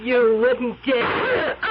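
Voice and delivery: very slow, daring challenge; slow menace. Acted. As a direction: dare him, drawing each word out. Witch faces the gun.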